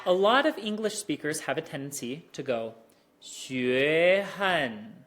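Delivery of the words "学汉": second tone rolling straight down, sounding like a fourth; slow demo of a learner's slurred tone change; tones run together. Shown as a demonstration. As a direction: roll the voice from the rise into a fall. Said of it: "that sounds like a fourth tone".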